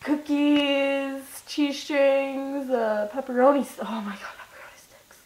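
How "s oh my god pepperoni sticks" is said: whispered; breathy whisper of longing. Real, not acted. Whispered craving aside.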